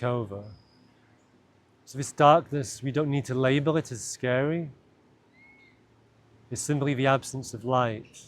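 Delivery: calm, unhurried meditation voice with long pauses; guided meditation. Acted. Guide leading listeners into an imagined cave, guided meditation. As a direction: lead them gently inward, leaving space between phrases.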